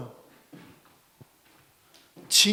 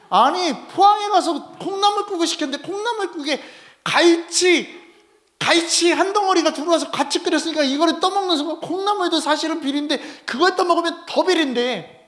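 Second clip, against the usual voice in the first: excited; 8 semitones higher, 5 dB louder than usual. Real, not acted. Excited food story.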